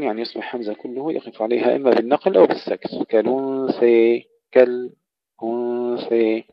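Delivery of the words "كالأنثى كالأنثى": "كالأنثى" with a brief stop before the hamza, slow; drawn-out "كالأنثى" showing the pause on the lam; sakt demonstration. Shown as a demonstration. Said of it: "فإن فيها السكت لخلف عن حمزة". ("كالأنثى" بالسكت ببطء)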